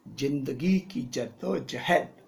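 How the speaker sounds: slow, puffed-up delivery; stiff and arrogant. Acted. Arrogant hero reading his first film line. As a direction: say it stiffly, full of yourself. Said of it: "ऐसे अकड़ के नहीं बोलना".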